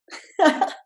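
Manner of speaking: bright burst of real laughter. Real, not acted. Mom laughing at her son's advice, real laughter.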